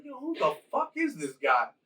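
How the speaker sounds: high, puzzled voice for the confused soldiers; mock baffled soldier. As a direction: toss it off like a baffled grunt. Soldiers react to Raiden appearing.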